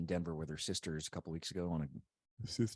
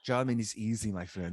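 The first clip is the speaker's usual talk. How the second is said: put-on German accent, a little louder; 3 semitones higher, 4 dB louder than usual. Shown as a demonstration. Co-host showing his friend that German is easy, in a German accent. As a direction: do a confident mock German accent. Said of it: "can't even get a German accent".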